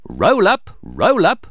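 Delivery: high, loud showman's cry; calling out. A narrator's reading. Miss Rabbit drumming up players at the fair, calling out. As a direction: call it out to draw a crowd. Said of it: "cries Miss Rabbit".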